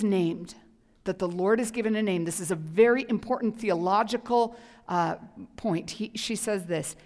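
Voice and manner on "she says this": usual speaking voice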